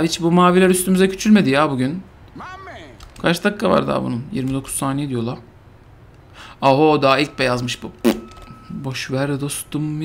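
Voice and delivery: normal talking voice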